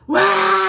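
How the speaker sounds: high, loud scream; terrified scream. Retold or imitated. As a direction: scream out of sleep paralysis. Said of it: "I screamed at the top of my lungs".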